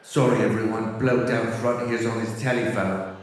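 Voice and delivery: lightly put-on English accent, low and dry; deadpan English rock star scolding a fan. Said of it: "And [name] steps up to his microphone. He goes".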